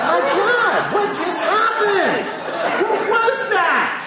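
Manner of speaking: high, loud astonished outburst; hammed-up astonishment; amazed. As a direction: exclaim in laughing disbelief. Amazed at his own calm.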